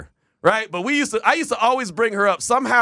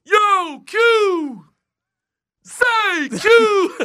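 high, loud hollering from outside; imitating Doug hollering; 6 dB louder, much slower than usual. Doug shouting for Q from outside, high and loud. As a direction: call him out of the house. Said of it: "He comes outside my house".

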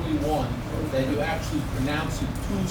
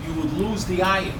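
ordinary talk, nothing marked

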